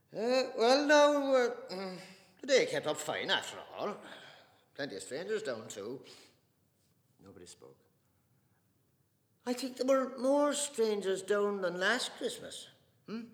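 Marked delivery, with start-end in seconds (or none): 0.1-6.0 loud, high, brittle small talk; forced cheer. Acted. Father breaking the silence at dinner, forced jolly. 9.5-13.2 slow, high and loud coaxing; coaxing father. Acted. Father coaxing the silent table, slow and loud.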